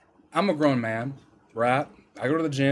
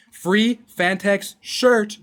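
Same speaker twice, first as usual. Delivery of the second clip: drawn out; 6 semitones higher, 6 dB louder, much slower than usual. Acted. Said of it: "ad read slow stretched".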